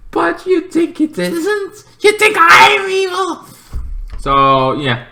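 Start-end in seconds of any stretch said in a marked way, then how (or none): 0.1-3.5 mock-injured squeak; hammed-up hurt disbelief; wounded Lex. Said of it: "Lex like".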